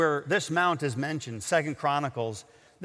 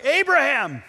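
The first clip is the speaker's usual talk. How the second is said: urgent call by name, higher and louder; 7 semitones higher, 5 dB louder than usual. Retold or imitated. Angel calls to Abraham as his hand is trembling, calling out. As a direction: call out to stop him.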